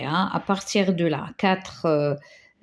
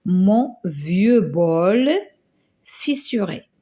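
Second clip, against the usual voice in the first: slow dictation; slower than usual. Shown as a demonstration. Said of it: "qu'est-ce qu'on va dire".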